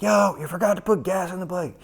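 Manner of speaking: very high yell across the pit wall; high mock scream to get someone's attention; imitated screaming. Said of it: "screaming at Muskie".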